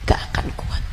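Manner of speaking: a hushed whisper after the shouting. Acted. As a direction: breathe the warning softly. (bisikan pelan)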